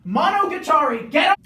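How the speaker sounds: high, loud call out; loud, high call to get someone moving. Real, not acted. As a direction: shout it across the room. Calling out loudly mid-answer.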